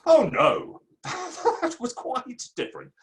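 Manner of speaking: amused laugh breaking through the words. Acted. Author laughing away a friend's accusation of plagiarism, amused. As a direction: chuckle him off, brushing the charge aside.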